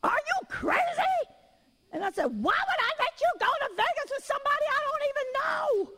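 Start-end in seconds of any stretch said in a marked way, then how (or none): 0.0-1.2 very high, drawn-out mock scream; retold scream. Mother retelling her outburst over a Vegas trip, mock scream. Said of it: "at first I screamed at her". 2.3-5.9 high-pitched mock screech held to the end; retold scream. Mother refusing a Vegas trip with strangers, mock scream. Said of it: "at first I screamed at her".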